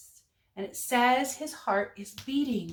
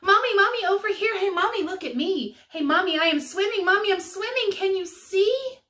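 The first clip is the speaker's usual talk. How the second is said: put-on high, loud, thrilled child calling; small penguin calling out in delight; excited little voice; 8 semitones higher, 6 dB louder, faster than usual. A narrator's reading. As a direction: shout to mommy, bursting with joy. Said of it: "came a little voice she knew".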